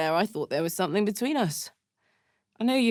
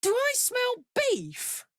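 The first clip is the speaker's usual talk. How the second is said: eager; slower than usual. Acted. Eager sniffing question.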